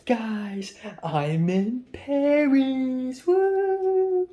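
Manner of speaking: high excited mock cheer; imitating friend's clip. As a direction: mimic a giddy travel clip. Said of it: "she's like".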